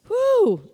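high, loud whoop; excited whoo. Real, not acted. Preacher stirred by her own message, whooping. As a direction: let out a sudden high whoo.

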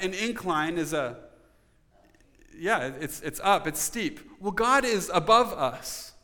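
ordinary delivery

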